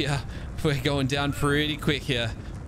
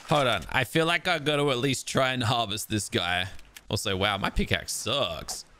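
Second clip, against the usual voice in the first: normal talking voice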